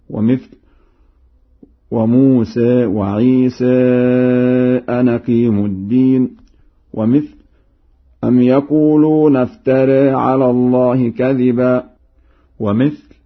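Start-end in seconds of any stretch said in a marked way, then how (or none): 1.9-6.3 tilt both endings in "وموسى وعيسى" toward ee; Warsh taqlil. Shown as a demonstration. Teacher gives more examples, chanting "وموسى وعيسى" with tilted endings. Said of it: "يقلل الألفات ذات الياء". 8.2-11.8 raised chant, "افترى" ending toward e; Warsh taqlil. Shown as a demonstration. Teacher gives an example, chanting "افترى" with tilted ending. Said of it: "يقلل الألفات ذات الياء والراء".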